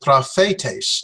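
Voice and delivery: "prophetes" as pra-FAY-tays; FAY stressed within "prophetes". Shown as a demonstration. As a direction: lean on the middle syllable of "prophetes". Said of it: "put our stress on the fe".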